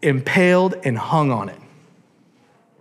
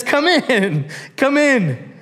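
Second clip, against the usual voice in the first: high, welcoming king voice; lively royal welcome; eager king; 9 semitones higher, much slower than usual. Acted. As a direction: call her in warmly like a pleased king.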